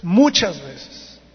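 MUCHAS stretched very slowly; emphatic contrast. Real, not acted. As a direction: draw out "muchas" long and weighty. Climax of a counted list. ("muchas" muy alargado y enfático)

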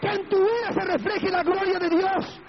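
high, sustained preacher's cry; impassioned preaching. Real, not acted. Preaches at full force. (predicación exaltada, en tono muy agudo)

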